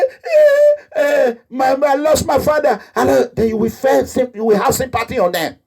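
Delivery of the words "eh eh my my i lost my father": high wailing fake sob over the lost father; mock crying. Girl fakes crying to a rich man for sympathy, mock wailing. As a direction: put on a tearful little whine to win pity.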